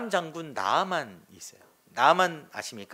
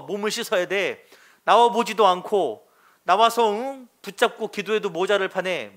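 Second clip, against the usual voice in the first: louder, higher indignant grumbling about the river; mock angry rant; 4 semitones higher, 4 dB louder than usual. Naaman scoffing at washing in a small river, indignant and louder. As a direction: huff and scoff like an offended general. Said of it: "나아만이 화가 났어요".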